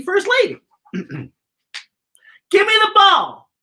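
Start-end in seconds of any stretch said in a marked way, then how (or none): 2.5-3.5 put-on high, loud demanding boy; kid shouting. Eight-year-old Joey demanding the ball, shouting.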